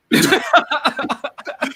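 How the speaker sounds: high burst of laughter; real laugh after the sour sip. Real, not acted.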